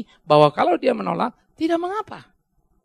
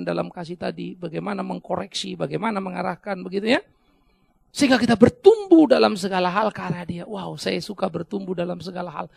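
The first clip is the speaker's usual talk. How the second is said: ordinary talk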